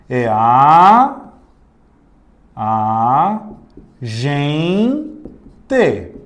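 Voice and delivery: a, gen-, te said apart with pauses; split into syllables. Shown as a demonstration. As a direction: spell out agente syllable by syllable. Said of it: "palavra agente". (separando as sílabas de agente)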